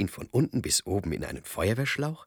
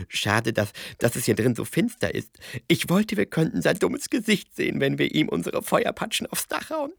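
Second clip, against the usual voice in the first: aufgeregt; 11 semitones higher, 5 dB louder than usual. Acted. Seppel freut sich auf Hotzenplotz.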